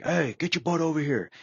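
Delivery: high, commanding call to a recruit; barking chief. Retold or imitated. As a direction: order the guy over, sharp and high. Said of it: "and he's like".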